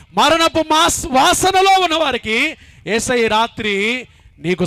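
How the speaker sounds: fiery sermon appeal building to hallelujah; preaching at full force. Acted.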